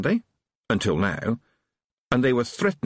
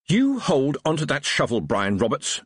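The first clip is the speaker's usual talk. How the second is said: screaming; 6 semitones higher than usual. A narrator's reading. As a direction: scream the order, outraged. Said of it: "she screamed at the sergeant".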